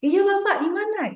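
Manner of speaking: high, bright "Iya Bapak"; match his high, lively pitch; matched lively reply. Shown as a demonstration. Student answering the lecturer with matched high energy. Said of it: "akan sefrekuensi".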